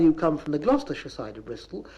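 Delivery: ordinary delivery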